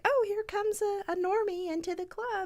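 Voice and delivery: high, snooty sneer; snotty goth kid. Retold or imitated. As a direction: look down your nose at the newcomer. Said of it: "that are like".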